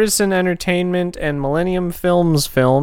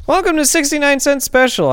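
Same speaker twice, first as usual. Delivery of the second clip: show host intro; 11 semitones higher than usual. Pretend.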